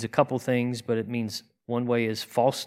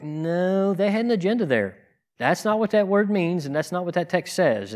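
higher-pitched, insistent disagreement; retold objection; 6 semitones higher than usual. Listener objecting to other pastors' readings, insistent. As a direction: push back on a preacher's reading, pitched up.

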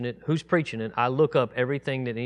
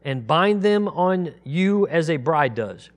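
raised, louder measured Bible reading; scripture reading; 6 semitones higher, 6 dB louder than usual. Acted. Teacher reading Isaiah aloud to the congregation, solemn raised reading. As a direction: proclaim each verse solemnly, slowing on the names of God.

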